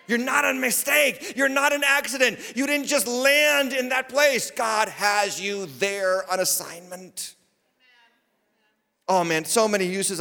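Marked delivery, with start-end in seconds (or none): 0.1-7.4 high, ringing preacher's cry; full-force preaching. Real, not acted. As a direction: preach it high, stretching out the last phrase.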